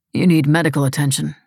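plain reading voice

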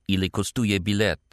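asked in native-sounding Polish; Polish question. A narrator's reading. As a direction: voice a young Polish girl asking the price. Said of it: "the girl could be heard asking".

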